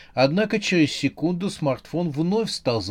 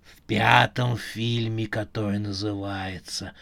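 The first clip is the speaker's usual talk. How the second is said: metallic voice; 4 semitones lower, 3 dB quieter than usual. Acted. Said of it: "металлический голос".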